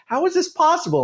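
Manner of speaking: sudden high squeak of disbelief; incredulous. Retold or imitated. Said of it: "And I'm like".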